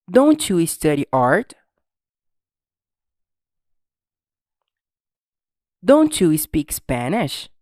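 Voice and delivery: slow, loud, carefully spaced questions with rising ends; language drill model. Shown as a demonstration. Teacher reads for students to repeat, slow projected drill voice. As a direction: read each question out slowly for a class to echo.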